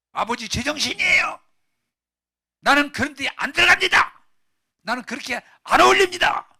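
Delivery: high, full-volume defiance; angry shouting. Acted. Older brother to his father refusing the feast, angry shouting. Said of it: "제가 소리 지르니까".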